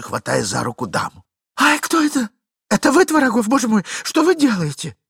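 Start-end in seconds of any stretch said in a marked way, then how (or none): 1.6-5.0 high, loud frightened yelp; a woman caught off guard; startled lady. Acted. Lady startled by Tvorogov grabbing her hand, high and loud.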